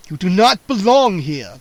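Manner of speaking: put-on loud booming old vampire warning. A narrator's reading. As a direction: boom it out to scare the intruders. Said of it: "the old vampire boomed".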